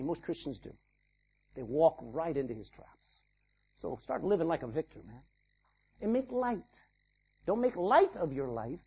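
ordinary delivery

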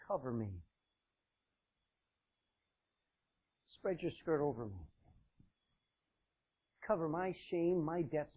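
soft, gentle appeal; hushed request. Acted. Woman asking her kinsman for protection, hushed. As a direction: ask it quietly, pausing between requests. Ruth softly pleads with Boaz.

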